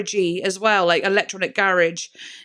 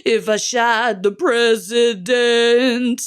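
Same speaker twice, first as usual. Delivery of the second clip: operatic rock voice; 2 semitones higher, 3 dB louder, much slower than usual. Retold or imitated. Imitating the singer's slow operatic line for viewers. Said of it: "rock and roll voice".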